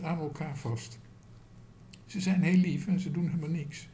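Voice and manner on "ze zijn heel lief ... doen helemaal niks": surprised. A narrator's reading. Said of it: "riep Otje verbaasd".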